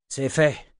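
short words after heavy effort; a touch out of breath; panting. A narrator's reading. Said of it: "haleta-t-il".

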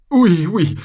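high, loud tearful cry; bursting into tears. A narrator's reading. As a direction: break down crying on it. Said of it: "en fondant en larmes".

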